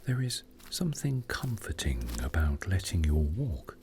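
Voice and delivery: ordinary delivery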